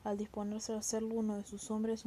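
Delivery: ordinary delivery, nothing marked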